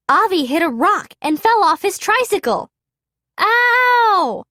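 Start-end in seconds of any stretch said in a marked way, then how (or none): 3.4-4.4 sudden high yelp of pain; cry of pain. A narrator's reading. Avi falling off his tricycle, high yelp. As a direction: yelp as you fall off the trike. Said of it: "Avi cried".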